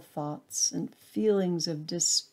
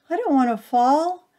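high, loud frightened cry; a small acorn cries out in terror; crying out in horror; 7 semitones higher, 7 dB louder than usual. A narrator's reading. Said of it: "it cries".

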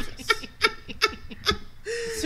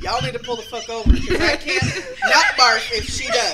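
laughing plea; 5 semitones higher, 8 dB louder than usual. Retold or imitated. Retold plea to pull over.

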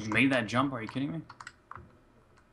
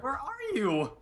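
much higher anxious question; squeaky worried call; 12 semitones higher, 4 dB quieter, much slower than usual. Real, not acted. Player searching for his friend, high and anxious.

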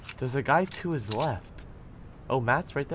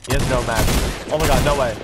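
ordinary delivery

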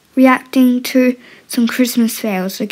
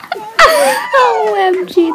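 laughing OMG; 10 semitones higher, much slower than usual. Real, not acted. Laughing at a clip.

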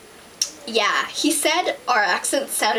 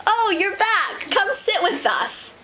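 high, drawn-out greeting; gushing welcome; 9 semitones higher, slower than usual. Acted. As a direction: welcome them back in a high singsong, slowly. Welcomes back the guest who left.

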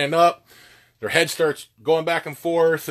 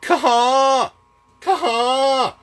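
high goose "Caw, caw"; spit moan; 11 semitones higher, 4 dB louder than usual. Shown as a demonstration. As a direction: honk it out like a goose. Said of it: "a spit moan is dropping that".

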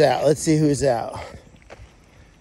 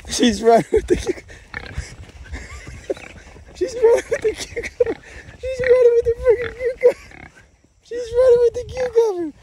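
very high, squeaky talk through laughter; squealing laughter. Real, not acted. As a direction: squeal it out while cracking up. Goat runs off with cucumber.

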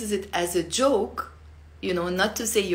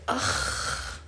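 pain groan; 5 dB quieter than usual. Shown as a demonstration. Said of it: "if I have pain".

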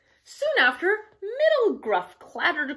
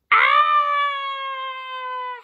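long, high, loud howl; troll's howl; 6 dB louder than usual. A narrator's reading. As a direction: howl out one sustained high "Ah!". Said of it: "howled the troll".

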